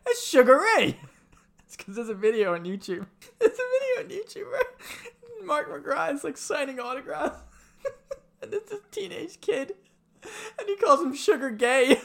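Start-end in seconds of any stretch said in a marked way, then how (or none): 0.1-1.0 louder, higher cry of recognition; excited exclamation. Retold or imitated. Friend retelling how they spotted the singer, excited exclamation. 3.4-7.4 high, fast, squeaky with real laughter; laughing. Real, not acted. Friend starting the YouTube story, really laughing. 8.4-12.0 high-pitched, real laughter through the words; laughing. Real, not acted. Friend telling of the teenage heckler, really laughing.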